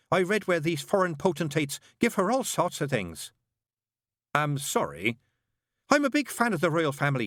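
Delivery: high, bright-voiced chattering nun; fussy nun. A narrator's reading. Nun greeting the father in a high voice. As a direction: pitch it high like a flustered nun. Narrator voices Sister Mary.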